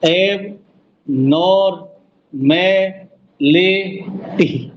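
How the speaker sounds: एब, नॉर, में, ले, टी as separate beats; syllable count. Shown as a demonstration. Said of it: "अब समझ में आ गया?". (एक-एक शब्दांश गिनते हुए)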